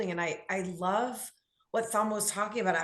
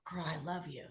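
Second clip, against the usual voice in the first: soft, much quieter loving words; tender reassurance; 4 semitones lower, 9 dB quieter, faster than usual. Pretend. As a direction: hold her gently with your voice. Comforting a friend in community.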